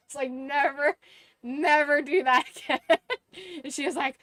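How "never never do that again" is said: a louder never, never do that, breaking into a laugh; giggling through a mock scolding; laughing warning. Laughing while warning customer.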